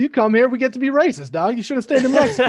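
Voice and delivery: high, loud hammed-up protest; imitated brash self-justification; mock indignant heckler. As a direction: argue loudly, laughter creeping in. Acts out the man's protest, laughing.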